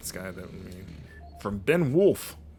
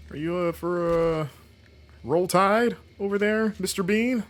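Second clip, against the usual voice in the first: mock baffled question; 10 semitones higher, 3 dB louder than usual. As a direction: drag it out, playing dumb to tease. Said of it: "I'd be like".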